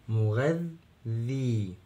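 مغذي very slowly, the ذ sounded twice; doubled dhal. Shown as a demonstration. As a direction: split مغذي on the doubled ذ, then a long ee. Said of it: "نطقنا الذال كم مرة؟". (نطق الذال مرتين في مغذي ببطء شديد)

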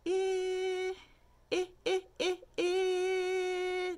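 high "eh", long, then short bursts, then long; held vowel. Shown as a demonstration. Teacher demonstrating letter I, "eh" kept going high and even. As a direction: sing out "eh" on one high, steady note. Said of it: "hear how you can keep it going".

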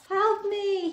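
high mock cry for help. Unicorn crying out in a pretend rescue game. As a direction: squeal it like a pretend victim. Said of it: "he'd cry".

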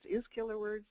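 soft, questioning repeat of her own phrase; mock puzzled echo. Speaker mocking her own grammar, soft and quizzical. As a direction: echo it back as if it sounds wrong. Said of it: "That doesn't sound like good English".

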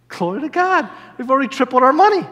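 high, thrilled exclamations; excited praise, pitched high; jubilant amazement. Retold or imitated. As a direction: marvel at the windfall. Rejoicing at money tripled.

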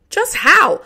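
fighting down terror. A narrator's reading. Child hears of the note to Boo Radley, fighting down fear. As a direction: ask it with terror held down. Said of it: "trying to fight down the automatic terror rising in me".

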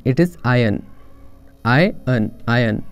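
iron said EYE-un, no r sound; careful teacher's model; correct pronunciation. Shown as a demonstration. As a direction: iron drilled, dropping the r. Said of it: "that is not iron".

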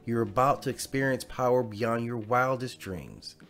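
usual speaking voice

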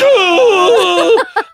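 high loud fake crying wail; cartoon cry shout; mock wail. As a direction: let out a hammed-up sob. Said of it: "aggressively crying".

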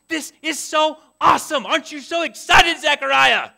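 loud, high, gushing excitement; excited best friend. Acted. Best friend telling Zechariah his news, loud and thrilled. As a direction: cheer on your best friend with huge excitement. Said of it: "Wouldn't you be pretty excited for him?".

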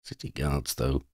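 quiet. A narrator's reading. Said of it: "Oddie reminded her".

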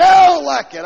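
high, loud mock protest; mock complainer. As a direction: shout like a sulking listener. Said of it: "You say".

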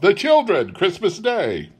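high-pitched reminder; Bob remonstrating. A narrator's reading. Bob reminds his wife of children and Christmas, reproving. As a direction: appeal to her to mind the occasion. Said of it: "said Bob".

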